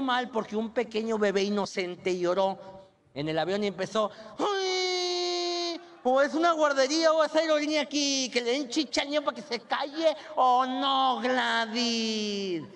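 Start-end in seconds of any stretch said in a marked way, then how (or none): 4.4-10.2 high, whining complaint with a cry; whiny chubby woman. Retold or imitated. Passenger complaining about luggage space, high whine. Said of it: "la voz chillona que te cae mal". 10.4-12.6 skinny friend's reply. Retold or imitated. Friend Gladys answering the complainer, drawn-out "oh no".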